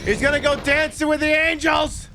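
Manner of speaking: high, loud theatrical wail; hammed-up dramatic cry. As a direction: announce the doom with mock grandeur. Mock dramatic cry at crash.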